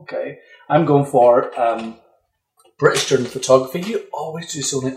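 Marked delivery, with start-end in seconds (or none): 0.7-3.8 talking out of the side of the mouth; out of mouth corner. Real, not acted. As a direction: speak from the corner of your mouth. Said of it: "I'm gonna talk out of the side of my mouth".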